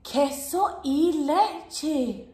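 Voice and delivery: very slow queso y leche; slow careful answer. Shown as a demonstration. Host answers slowly for the children. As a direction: spell out each word slowly for little ears.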